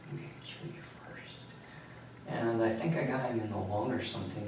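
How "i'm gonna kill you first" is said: whispered retold threat, much softer; hushed threat. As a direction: breathe the threat out without voice. Attacker's threat during the fight.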